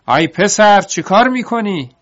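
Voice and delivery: high, loud panicked cry; alarmed shout. Acted. Tied-up master shouting after his fleeing servant, high alarmed yell. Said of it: "ارباب فریاد زد".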